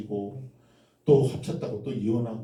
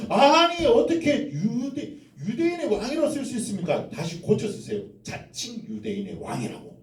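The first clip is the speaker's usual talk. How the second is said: high, outraged protest; indignant leaders; 10 semitones higher than usual. Acted. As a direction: protest it like an offended priest. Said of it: "종교 지도자들이 뭐라고 하냐면".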